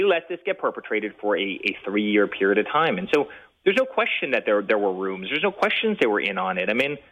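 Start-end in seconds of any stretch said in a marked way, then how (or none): none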